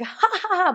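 high teasing mock ha ha ha; mock laugh. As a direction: cackle lightly like a teasing grandmother. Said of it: "laughing, like".

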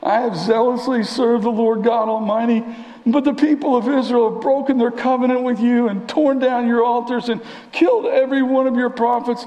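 high-pitched, self-pitying whine; hammed-up whiny complaint. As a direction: whine it out like a wronged prophet. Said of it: "read this in the most whiny voice".

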